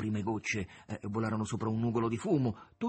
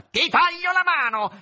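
loud, high, drawn-out threat; fierce high yell of warning; menacing shout; 12 dB louder, slower than usual. A narrator's reading. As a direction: roar the threat from afar. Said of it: "gridò minaccioso dalla sella".

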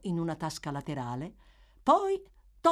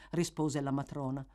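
ordinary delivery

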